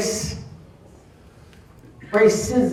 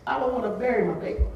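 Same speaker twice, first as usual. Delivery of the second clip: crying; 6 dB quieter, faster than usual. Real, not acted. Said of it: "I'm crying because".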